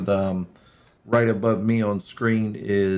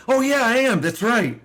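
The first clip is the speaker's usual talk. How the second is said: high, hammed-up sure-of-himself reply; teasing a friend with a mock high "oh yeah, I am"; mock sudden confidence; 12 semitones higher than usual. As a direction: play the friend suddenly remembering who he is. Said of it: "It's like".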